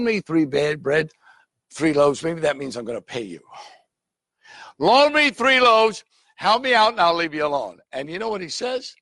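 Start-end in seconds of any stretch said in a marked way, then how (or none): 4.8-7.8 loud pleading. Acted. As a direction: beg loudly for the bread. Caller pleading at the window.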